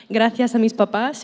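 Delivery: ordinary talk, nothing marked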